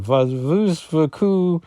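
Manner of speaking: lightly voiced sly French line; a hint of coy teasing, pitch lifting. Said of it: "looking very sly and saying".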